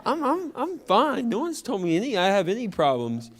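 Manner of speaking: high, defensive and baffled; young boy insisting he's fine; indignant surprise. Retold or imitated. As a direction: push back, shocked at the question. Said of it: "And I was like".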